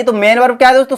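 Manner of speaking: ordinary talk, nothing marked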